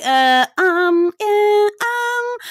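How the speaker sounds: high-pitched, drawn-out hemming; mock hesitant stalling. Woman dodging a question about a man in bed, mock hesitant. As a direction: imitate someone squirming over an answer, high-pitched.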